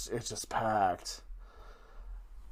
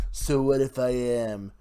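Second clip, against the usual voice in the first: badly faked politician voice, emphatic pauses; Donald Trump. Podcaster defending the bad impression, still doing a mock Trump. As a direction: boast in short emphatic chunks as a mock president. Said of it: "try to do a Donald Trump impression".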